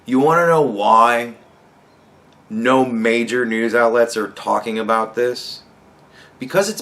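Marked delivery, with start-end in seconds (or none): none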